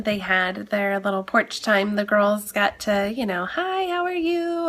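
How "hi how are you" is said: put-on cheery kid greeting. Kids greeting the visiting client.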